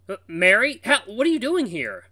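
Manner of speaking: startled and loud, rising high; alarmed. Acted. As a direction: caught off guard, voice jumping up. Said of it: "your alarmed tone".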